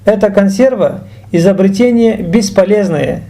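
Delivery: usual speaking voice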